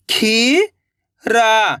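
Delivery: खीरा held out very slowly, each sound drawn long. Shown as a demonstration. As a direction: stretch खीरा sound by sound for the learner. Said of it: "तो मिलके बन जाएगा". (खीरा को बहुत धीरे खींचकर बोलें)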